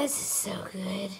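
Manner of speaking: unvoiced secret aside; whisper. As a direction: breathe it to the camera. Aside to the camera.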